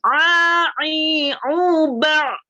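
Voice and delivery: teacher demonstrating ain; ain drill. Shown as a demonstration. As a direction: tight throaty "A", "i", "u". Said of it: "Jadi dia agak ke tengah".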